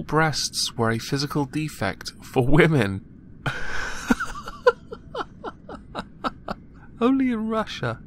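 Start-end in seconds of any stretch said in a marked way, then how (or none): 3.3-6.5 high-pitched burst of real laughter; cracking up at the news; big laugh. Real, not acted. Laughing hard at the Russian ad ruling. 7.0-8.0 laughing. Real, not acted. Mocking Russia after laughing.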